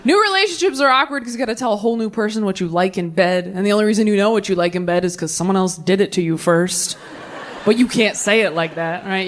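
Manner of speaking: ordinary talk, nothing marked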